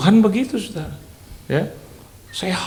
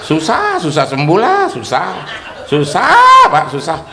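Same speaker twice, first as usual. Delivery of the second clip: laughing whine; 4 semitones higher, 7 dB louder, slower than usual. Retold or imitated. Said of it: "Pak Johan, Pak Pendeta".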